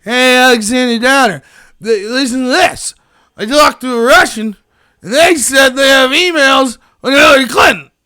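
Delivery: put-on loud drunk slurring to a friend. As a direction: blurt it out like a tipsy braggart. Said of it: "George Papadopoulos gets drunk and says".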